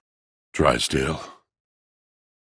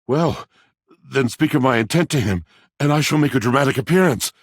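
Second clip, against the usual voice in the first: much higher, quick and thrilled; eager excitement; 8 semitones higher, faster than usual. Acted. Planning a dramatic appearance for Drysdale, high eager excitement. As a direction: rush on with delight.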